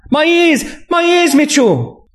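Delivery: high-pitched cry of pain; pained female character. A narrator's reading. Woman cries out in pain.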